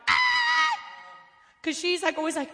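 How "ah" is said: high delighted shriek; excited scream. Retold or imitated. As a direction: shriek with joy from the kitchen. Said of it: "Her mom's in the kitchen like".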